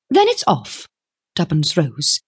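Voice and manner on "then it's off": high-pitched firm refusal; decisive young woman. A narrator's reading. Young woman breaks off the deal, decisive. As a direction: call it off in a high voice.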